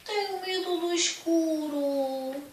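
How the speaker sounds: high, slow, frightened; scared little bear. Acted. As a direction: say it timidly. (voz aguda e assustada)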